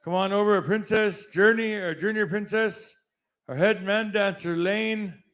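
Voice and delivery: announcer introducing names; powwow emcee. Acted. Introducing committee members.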